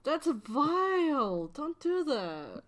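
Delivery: high, slow, drawn-out disgust. Real, not acted. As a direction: recoil in mock horror. Disgusted protest at a crude joke.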